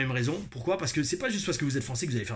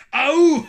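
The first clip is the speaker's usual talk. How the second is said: high, loud playful howl; 11 semitones higher, 11 dB louder than usual. Acted. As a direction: let out a high "aouh" whoop. Howls at end of acted message. (hurlement aigu pour rire)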